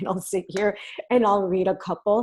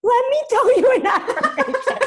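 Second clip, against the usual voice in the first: high, loud, laughing mock mom; laughing mom impression; 9 dB louder than usual. Daughter voicing her mom offering a comforting affirmation. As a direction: play the eager mom through laughter.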